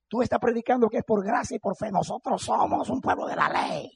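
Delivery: high-pitched mock accusation from the objectors; accusing church leaders. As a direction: imitate indignant leaders laying down the rule. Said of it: "me dijeron".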